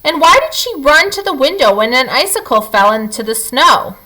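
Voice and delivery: ordinary delivery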